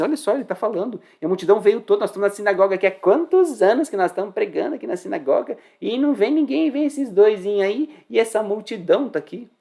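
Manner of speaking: high, agitated mock complaint; imitated jealous synagogue crowd, worked up; envious grumbling. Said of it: "eles estavam lá falando assim".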